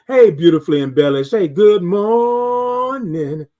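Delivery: loud sing-out with a long drawn "morning"; calling out a greeting. Real, not acted. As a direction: greet a newcomer heartily, stretching the last word. Greets viewer joining the live stream.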